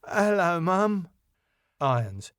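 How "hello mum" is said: higher-pitched, tipsy greeting; sheepishly sozzled; drunk. Acted.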